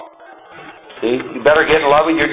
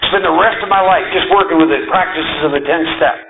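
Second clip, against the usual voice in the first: ordinary talk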